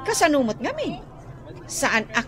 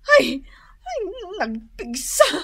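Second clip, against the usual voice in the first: disgusted cry; 6 semitones higher than usual. Acted. Disgusted at driver's smell.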